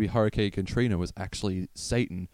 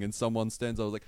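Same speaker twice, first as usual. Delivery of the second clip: ordinary talk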